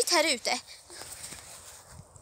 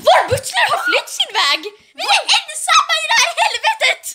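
high-pitched frantic wail; hammed-up panic; 11 semitones higher, faster than usual. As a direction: cry it out in melodramatic panic. Panicked news that butler has fled. (överdrivet panikslaget, gällt utrop)

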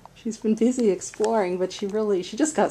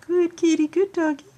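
squeaky praising a dog; 6 semitones higher, faster than usual. Real, not acted. Praising the new pets.

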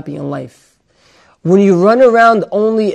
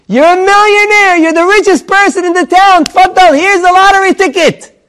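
much higher, louder, bursting with joy; excited news; 13 semitones higher, 8 dB louder than usual. Acted. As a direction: shout the good news at the door. Friend bursting with lottery news.

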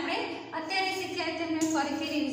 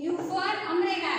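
normal talking voice

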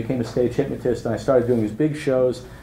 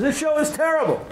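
high, whiny mock complaint; heckler complaint. Heckler telling the hypnotist his show is terrible, high whine.

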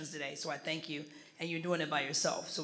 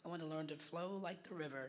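much softer, hesitant try at "flow like the river"; tentative attempt; 12 dB quieter than usual. Real, not acted. Student copying the coach, soft and unsure. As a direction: say it timidly, not flowing yet. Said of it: "you gotta work on that".

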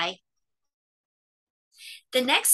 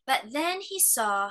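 ordinary delivery

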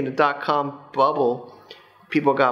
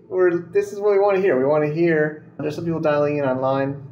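usual speaking voice

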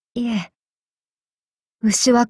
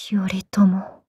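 whisper; 3 semitones lower, 4 dB quieter than usual. Acted. Hissing enemy's name.

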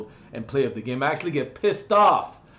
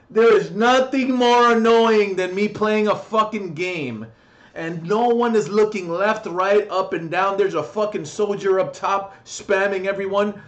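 angry rant; 5 semitones higher, 6 dB louder than usual. Real, not acted. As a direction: vent at lazy teammates. Ranting about teammates.

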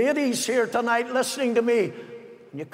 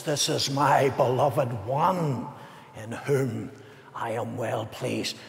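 voice of God the Father; 7 semitones lower, 4 dB quieter than usual. Acted. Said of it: "But God the Father says".

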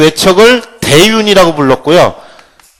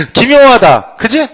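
mock king; 6 semitones higher, slower than usual. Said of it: "이렇게 할 것 같아?".

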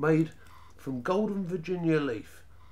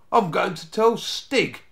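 slow, deliberate, raised voice; firm, unhurried boyish threat; calm announcement; 8 semitones higher, 9 dB louder than usual. A narrator's reading. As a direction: state it slowly and confidently. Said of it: "he said calmly".

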